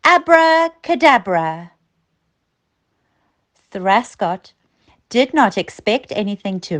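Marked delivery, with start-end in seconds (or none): 0.0-1.7 higher, louder magic word called out; shouted spell. Acted. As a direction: wave the wand and cry the spell.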